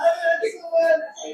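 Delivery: slow sobbing wail; crying loudly. Real, not acted. Woman wailing her six years of pain to the healer, sobbing. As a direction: wail it out through tears. Patient weeping at healer's feet.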